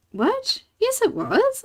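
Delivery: high-pitched imitation of an incredulous mum; retold disbelief. Mum insisting to her child that it was them, mock disbelief. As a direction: mimic her, voice leaping right up.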